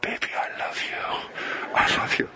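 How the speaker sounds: whispering sweet nothings, imitating the roommate; breathy mock whisper of a smooth talker; imitated whisper. Roommate whispering to a girl on the phone, imitated whisper. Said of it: "he'd be going".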